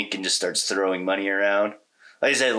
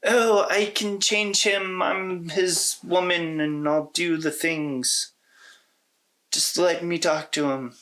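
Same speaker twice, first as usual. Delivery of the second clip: high, slow, swooning mock heroine voice; hammed-up devoted girlfriend pleading for her man; mocking lovestruck heroine; 10 semitones higher, slower than usual. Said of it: "Flora is your typical".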